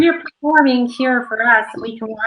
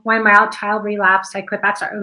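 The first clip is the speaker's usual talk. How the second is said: flat, even read with no feeling; monotone. Shown as a demonstration. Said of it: "we just kinda read it in a monotone".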